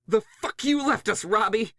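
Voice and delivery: high, loud furious scream; shrieking accusation at the one who fled. Acted. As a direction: scream it at him in betrayed rage. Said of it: "she screamed".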